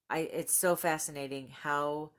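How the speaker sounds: ordinary talk, nothing marked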